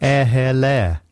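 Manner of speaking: slow, posh English vowels; posh English accent. Acted. Showing the phonetic greeting trick, posh English accent. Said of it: "the one that makes you sound posh".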